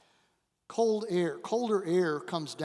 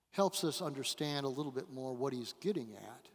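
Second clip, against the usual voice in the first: ordinary delivery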